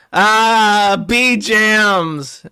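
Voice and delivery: high, loud "ah, bee jams"; mock yell. Reading AI script, voicing Worski's loud goofy outburst.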